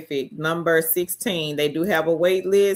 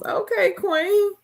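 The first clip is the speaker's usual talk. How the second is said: high excited cheer; slower than usual. Real, not acted. Cheering a viewer.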